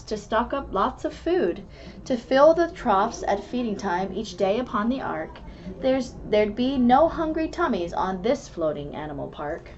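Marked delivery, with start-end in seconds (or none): none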